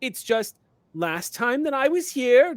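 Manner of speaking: high, loud, hurried denials; panicked pleading. Acted. Ameep panics before the mountain spirit, high pleading. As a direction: backpedal fast, scared of the giant. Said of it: "Meep turns and goes".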